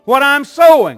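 loud, rallying call to the congregation; fervent church shout; preacher's declaration. Real, not acted. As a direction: call it out for the crowd to repeat.

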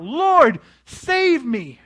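loud, high plea for rescue; fearful cry. Retold or imitated. Disciples crying out to Jesus on the water, fearful. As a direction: cry out as if sinking. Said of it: "Crying out in fear, they cried out".

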